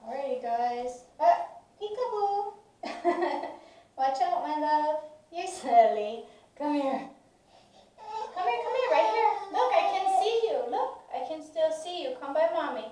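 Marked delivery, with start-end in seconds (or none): 1.2-12.8 higher, louder playful calling; playful peekaboo. Real, not acted. Mother playing peekaboo with her toddler, excited and playful. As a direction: call him over in play.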